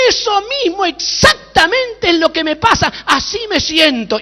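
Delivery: high, loud burst of recognition; acted emphatic outburst. Judge suddenly confirming the pastor's word, acted loud outburst.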